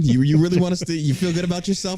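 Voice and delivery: indignant New Yorker. Real, not acted. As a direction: challenge them with New York attitude. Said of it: "I certainly turned New York".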